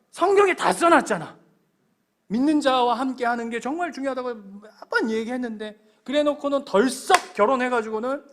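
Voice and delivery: loud, rising exasperation; exasperated scolding. Acted. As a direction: protest like a fed-up father who warned them. God scolding disobedient children.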